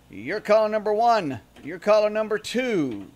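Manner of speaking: excited caller countdown; radio contest promo. Acted. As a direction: DJ calling out caller numbers.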